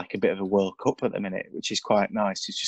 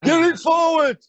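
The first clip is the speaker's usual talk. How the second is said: high shouted terrace demand; fan shout; 7 dB louder than usual. Retold or imitated. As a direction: yell it from the stands. Imitating fans shouting at football.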